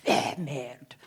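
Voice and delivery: dismissive puff, then a low French curse; mutual-eyeroll French exasperation, dropped low; exasperated French reaction. Acted. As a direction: toss it off like a fed-up Parisian. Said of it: "my reaction in my head was basically like".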